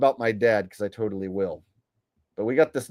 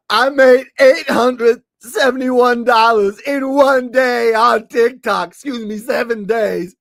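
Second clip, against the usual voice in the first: high, loud, slow parody; mocking the TikToker, high and slow; mock whiny boast; 7 semitones higher, 9 dB louder than usual. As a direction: stretch his boast out in a high whine.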